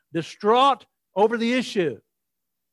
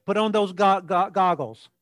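usual speaking voice

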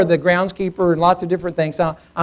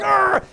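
high exasperated growl; mock roar of rage; angry growl; 14 semitones higher than usual. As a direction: growl in retold frustration. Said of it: "I'm even more mad".

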